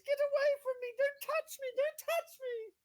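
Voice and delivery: unearthly voice. Acted. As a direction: wail like a frantic ghost. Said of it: "she howls in an unearthly voice".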